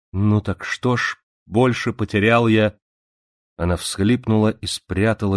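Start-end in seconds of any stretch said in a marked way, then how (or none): 0.1-2.8 voice trembling. Acted. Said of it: "голос её дрогнул".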